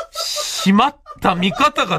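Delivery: can't work the camera, slow mock dismay. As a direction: drag out the oops. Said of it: "っつって".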